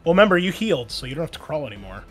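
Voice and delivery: usual speaking voice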